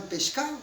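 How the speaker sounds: "pescado" as "peshcao", sh and no d. Shown as a demonstration. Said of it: "Y otros dirán". ("pescado" con sh y sin d: "peshcao")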